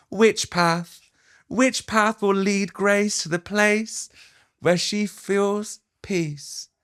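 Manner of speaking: slow, high dramatic poetry recital; spoken word poem. Pretend. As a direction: perform it like a slam poet, stretching every line. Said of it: "Go on, do a spoken word about me".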